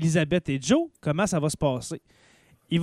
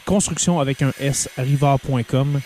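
ad read; 4 semitones lower than usual. Acted. Sponsored ad read.

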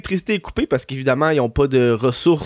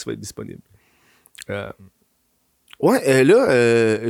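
ordinary talk, nothing marked

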